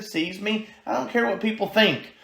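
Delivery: ordinary talk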